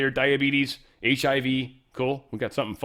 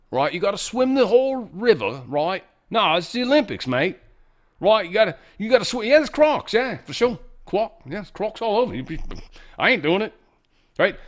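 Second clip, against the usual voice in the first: mock Australian accent; 8 semitones higher, 3 dB louder than usual. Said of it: "this is so Australian".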